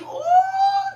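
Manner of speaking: a sudden high-pitched cry of ooh; spirit-filled high wail; high ecstatic wail. Real, not acted. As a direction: let a falsetto ooh burst out. Preacher caught by the Spirit.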